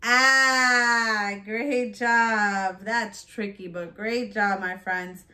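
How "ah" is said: "Ah" as a long held short-a vowel; middle sound alone. Shown as a demonstration. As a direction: stretch out "Ah" as in hat. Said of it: "What's the middle?".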